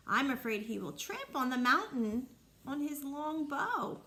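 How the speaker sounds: plain reading voice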